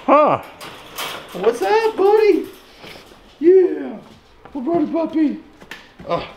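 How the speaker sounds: very high, excited puppy voice. Real, not acted. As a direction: squeal greetings up high at the dog. Greeting the dog Gunder.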